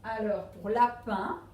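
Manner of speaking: ordinary talk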